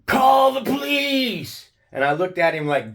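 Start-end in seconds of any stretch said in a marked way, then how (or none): 0.1-1.7 much higher, slower mock shriek; retold scream. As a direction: shriek it like a panicked man calling for help. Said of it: "screams at the Sergeant Hooper".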